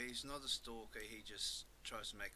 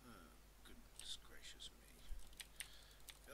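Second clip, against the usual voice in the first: slow unvoiced whisper; 4 semitones lower, 8 dB quieter, much slower than usual. Real, not acted. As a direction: breathe it out slowly to yourself. Muttering at game monsters.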